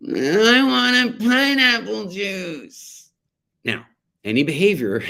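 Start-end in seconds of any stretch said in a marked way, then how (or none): 0.1-3.1 put-on high, slow, drawn-out child's whine; whiny toddler voice. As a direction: mimic a little girl demanding juice. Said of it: "And so she goes".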